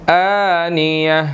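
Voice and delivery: slow, drawn-out tajweed recitation; slow Quranic recitation. Shown as a demonstration. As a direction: recite slowly with long vowels. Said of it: "teacher recites the verse".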